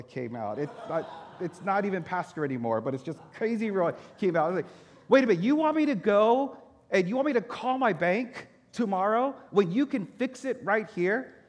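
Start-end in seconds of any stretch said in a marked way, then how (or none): 5.1-11.3 raised, loud, indignant challenge, slowing at the end; angry indignant demand. Retold or imitated. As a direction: throw the question back at him in outrage. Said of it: "I'm saying it just like that in that tone of voice".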